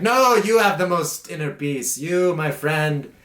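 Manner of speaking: monk flatteringly praising the other, theatrical; Jim Carrey style gushing. Pretend. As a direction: ham up a grand cosmic compliment. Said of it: "I'm throwing some Jim Carrey lines at you".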